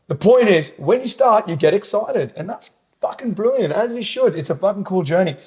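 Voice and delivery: excited. Real, not acted. Excited about starting out.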